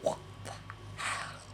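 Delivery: breathless, hushed disbelief; stunned whisper. Real, not acted. Vlogger shocked by the NHS news, hushed disbelief. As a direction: gasp it under your breath in shock.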